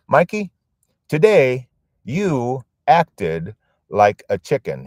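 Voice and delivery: a high, loud, slow grandfather's chiding; grandfather scolding. Retold or imitated. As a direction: tease the boy like a grandpa. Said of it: "he'd tap me and he'd say".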